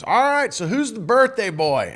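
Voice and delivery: imitated big, hearty hello; boisterous greeting. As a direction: greet the birthday boy loudly, as the host. Herbie's boisterous greeting, retold.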